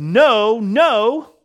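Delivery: loud, high-pitched, drawn-out no; emphatic refusal. Acted. Preacher quoting a Bible translation's answer to the congregation, emphatic refusal. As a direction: proclaim it forcefully, pitched well up.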